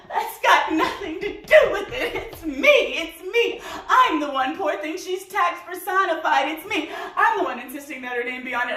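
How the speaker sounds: high-pitched, frantic, indignant rant; hysterical tirade. Acted. Fiancée insisting she is the one blamed, hysterical. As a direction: rail at him in a high, worked-up voice.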